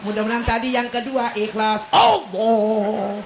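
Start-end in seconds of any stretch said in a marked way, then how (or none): 1.9-3.2 punched start falling away; mock sulky shout. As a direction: imitate a grudging, forceful response. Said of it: "kalau kenceng bilang ngambek". (meniru seruan kenceng orang ngambek)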